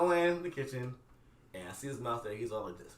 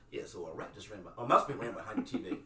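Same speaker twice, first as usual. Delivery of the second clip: calm, offhand; 12 semitones lower, 8 dB quieter than usual. Retold or imitated. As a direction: lower and softer, unbothered. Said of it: "Carter calmly goes".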